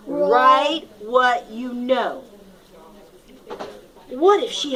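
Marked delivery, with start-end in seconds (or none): 0.1-2.2 slow, deliberate rule read; slow announcement. Shown as a demonstration. Teacher slowly reads rule five to the class. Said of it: "announcing rule five".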